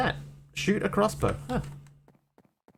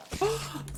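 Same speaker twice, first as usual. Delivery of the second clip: high cartoon gasp; 11 dB quieter than usual. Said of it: "From The Simpsons".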